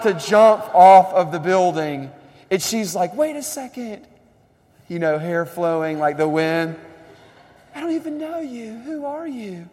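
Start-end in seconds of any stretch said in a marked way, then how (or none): 3.1-4.1 much higher mock female voice; preacher mimicking Rachel stopping Batman, high-pitched; Rachel pleading. 7.7-9.7 much higher mock female voice; preacher mimicking Rachel stopping Batman, high-pitched; Rachel pleading. Acting Rachel questioning Batman.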